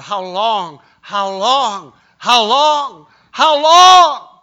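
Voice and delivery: from loud to a high, ringing cry; the martyrs' cry mounting toward heaven; building cry. Acted. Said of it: "they're crying out".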